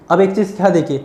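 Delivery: ordinary talk, nothing marked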